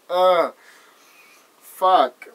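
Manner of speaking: ordinary talk, nothing marked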